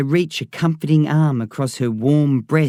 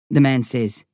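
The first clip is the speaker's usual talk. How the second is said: usual speaking voice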